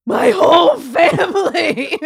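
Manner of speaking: slow, high, loud mock wail of grief; mock anguished wail. Driver wailing after the crash, mock grief. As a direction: wail it like a man who just lost everything. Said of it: "and then you'd go".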